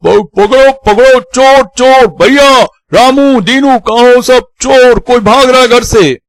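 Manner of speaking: high, loud cries raising the household; alarmed shouting. Acted. Uncle sees someone fleeing the house, high alarmed shouting. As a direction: stammer into a thief alarm at full shout. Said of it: "वो चीख पड़े".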